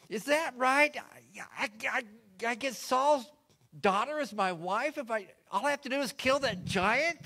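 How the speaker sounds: can't believe his luck; eager question. Acted. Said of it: "He says".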